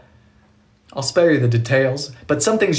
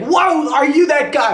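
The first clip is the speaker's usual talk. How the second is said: high-pitched mock astonishment, slower; imitated amazement; 10 semitones higher, 3 dB louder, slower than usual. Internet installer recognizing the host, amazed. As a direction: act out a starstruck double take.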